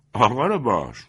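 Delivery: loud mocking scoff; best man scoffing loudly at the haughty guest; scoffing exclamation. A narrator's reading. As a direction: scoff at his airs.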